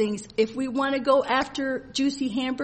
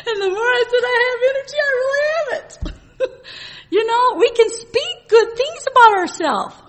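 high, loud, tearful laughter; laughing through tears; 11 semitones higher, 8 dB louder than usual. Real, not acted. Preacher to congregation, laughing and crying with real joy. Laughs and cries while testifying.

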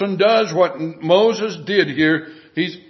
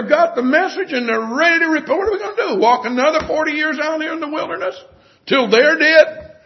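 exasperated Moses; 6 semitones higher than usual. Acted. Moses voiced, fed up with people.

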